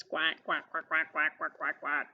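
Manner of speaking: low, rapid-fire run of quacks; low-pitched duck chatter; ducklings quacking. Acted. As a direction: imitate a string of ducklings quacking. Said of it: "Here are her little ducklings".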